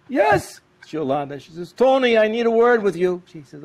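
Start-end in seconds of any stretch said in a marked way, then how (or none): 0.1-0.6 very high, loud testy call through the door; irritated yes. Retold or imitated. Tony answering the knock at his study door, high and testy. 1.7-3.2 high, loud call through a door; wife calling. Retold or imitated. Wife calling Tony through the locked door, high and loud.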